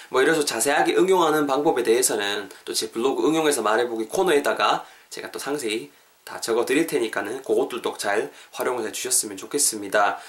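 ordinary talk

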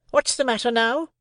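high-pitched, sharply put question; sharp demand. A narrator's reading. Sister pressing Joe for an answer, sharply. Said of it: "repeated my sister more sharply than before".

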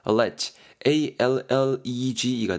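한 글자씩 느리게 또박또박 철자 불러주기; 받아 적게 천천히 철자 읽기. Shown as a demonstration. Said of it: "철자를 천천히 불러줌". (철자를 아주 느리게 한 자씩)

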